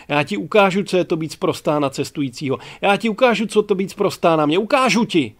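loud, high, mounting rant; furious shouting. A narrator's reading. Passenger rants in his empty cabin, furious shouting. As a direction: yell the threats, building to the last words. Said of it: "vykřikl".

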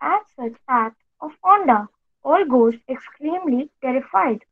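plain reading voice

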